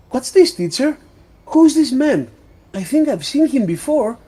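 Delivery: put-on high, loud childlike voice; excited little girl. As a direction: pipe up urgently like a curious child. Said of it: "Gulue, who at this time is outside".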